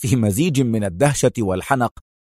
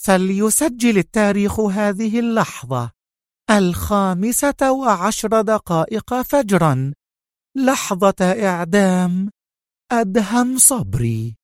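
higher, slow grand proclamation; solemn excitement; 9 semitones higher than usual. A narrator's reading. Sonia announces Adham's execution time, slow grand proclamation. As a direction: savor each word of triumph. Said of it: "وقالت في انفعال".

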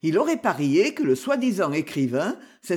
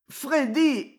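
higher, drawn-out plea for Freddy; imploring; 8 semitones higher than usual. A narrator's reading. The spy pleads with Freddy as he goes. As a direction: beg him not to leave. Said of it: "implora l'espion".

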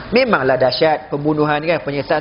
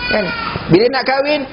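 normal talking voice

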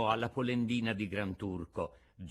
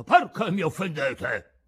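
loud, higher-pitched indignant outburst; enraged; 5 semitones higher, 7 dB louder than usual. A narrator's reading. Old man turns on the carpenter, loud and enraged. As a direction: demand an explanation, loudly offended. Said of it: "gli disse imbestialito".

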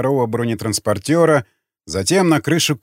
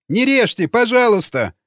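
high, tearful begging, lightly acted; sobbing plea; 9 semitones higher than usual. A narrator's reading. Prisoner begs raiders not to cut him, sobbing plea. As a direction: plead in a high wail. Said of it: "зарыдал боевик".

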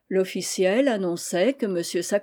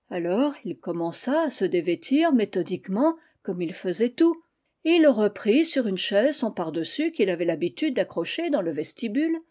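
usual speaking voice

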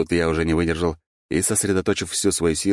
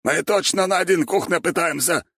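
strained protest; 13 semitones higher than usual. A narrator's reading. Straining loser protests during arm wrestling.

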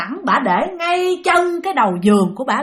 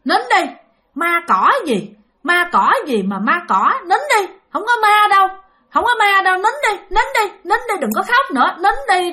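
hushing grandmother; 7 semitones higher, 3 dB louder than usual. Retold or imitated. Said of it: "cái bà mới nói rằng".

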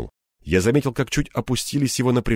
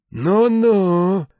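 high, drawn-out musing; thoughtful drawl; 10 semitones higher, 4 dB louder than usual. A narrator's reading. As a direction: stretch it out as you weigh his words. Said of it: "задумчиво протянул старший". (задумчиво протянуть)

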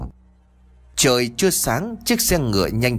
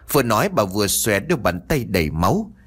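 usual speaking voice

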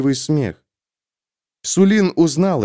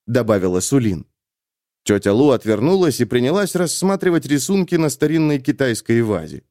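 ordinary delivery, nothing marked

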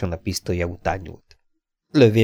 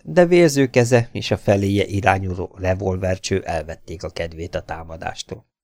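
usual speaking voice

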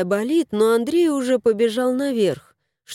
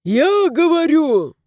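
loud, high growled retort; 7 semitones higher, 4 dB louder, slower than usual. A narrator's reading. House spirit answers the vet, growling. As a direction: snap it like a growl. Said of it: "рыкнул домовой".

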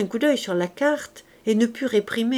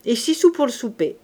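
cool, matter-of-fact itemizing; coldly; 3 semitones higher, 4 dB louder than usual. A narrator's reading. Husband itemizes the bill to his wife, coldly. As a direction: finish the sum without feeling. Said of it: "reprit le Thénardier froidement".

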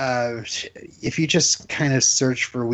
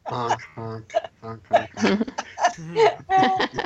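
silly clown; 9 semitones higher, slower than usual. Pretend. Silly honking after reading feedback.

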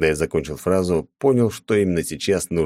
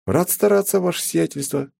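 с усмешкой; 12 semitones higher than usual. A narrator's reading. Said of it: "усмехнулся я".

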